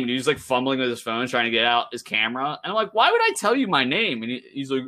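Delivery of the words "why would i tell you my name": much higher, scornful retort; defiant retort. Retold or imitated. Retelling his defiant answer to the worker, much higher. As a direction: throw the question back in disbelief.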